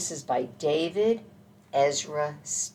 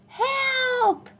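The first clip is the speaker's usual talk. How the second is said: high, loud mock panicked cry; cry for help; 5 dB louder than usual. A narrator's reading. Scared dog running from mama squirrel, high mock cry for help. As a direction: yelp it out, pitched way up.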